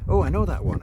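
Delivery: loud, high furious outburst; furious exclamation. Acted. Niece furious at her uncle's joke, loud and high. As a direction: snap back in fury. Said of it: "exclaims Zazie, furious".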